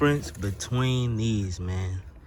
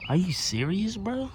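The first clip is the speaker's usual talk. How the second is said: incredulous; 9 semitones higher than usual. Real, not acted. Disbelief at sub size.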